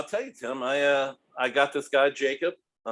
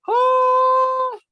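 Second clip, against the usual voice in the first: exclamation; 8 dB louder than usual. Retold or imitated. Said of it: "And I'm just like".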